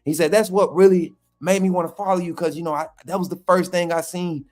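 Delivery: the coach praising him, in his voice; Jamarcus Shepherd voice. Retold or imitated. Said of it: "this my Jamarcus Shepherd voice".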